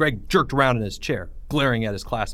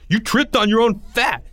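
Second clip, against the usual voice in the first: yelling; 11 semitones higher, 5 dB louder than usual. Acted. Greg yelling in fight.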